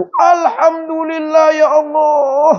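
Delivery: slow, high sobbing thanks; tearful alhamdulillah. Retold or imitated. Visitor cries out thanks to God on hearing the news. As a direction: pour out relief through tears.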